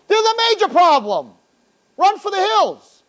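high, slow, urgent warning; hammed-up panic, drawn out; mock alarm. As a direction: sound the alarm, half joking. Mock alarm about the danger.

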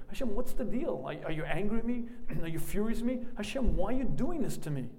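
a frustrated man crying out to God; frustrated plea. Retold or imitated. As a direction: demand answers from God, aggrieved.

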